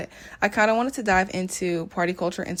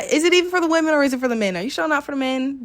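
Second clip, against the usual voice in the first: high, loud mock interrogation; incredulous questioning; 7 semitones higher, 6 dB louder, faster than usual. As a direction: grill them in playful disbelief. Mock interrogation of beachgoers in jeans.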